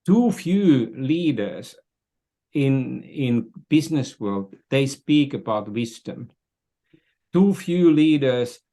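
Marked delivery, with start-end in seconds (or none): none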